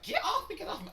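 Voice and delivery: mock her shrill yelp; mocking Mary. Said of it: "she was like".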